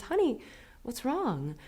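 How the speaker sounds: a mother gently asking her hurt son; lightly voiced mom, soft and high; tender mom voice. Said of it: "she says".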